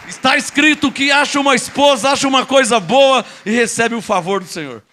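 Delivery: preaching. Acted. Preaching a verse loudly.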